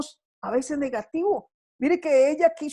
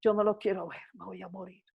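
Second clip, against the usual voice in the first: softer, hushed despairing plea; 5 semitones lower, 9 dB quieter, faster than usual. Retold or imitated. Mother in the desert begging God, hushed despair. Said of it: "y dijo".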